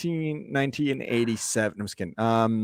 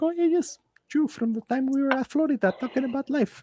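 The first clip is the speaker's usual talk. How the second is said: much higher, eager voice of a host mom remembering you; Sandra accent; 13 semitones higher than usual. Retold or imitated. Host mom warmly recalling an old acquaintance, much higher imitated voice. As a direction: gush it out way up high, like you know everyone. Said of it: "that's my best Sandra accent".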